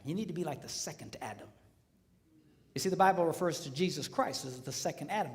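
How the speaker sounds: normal talking voice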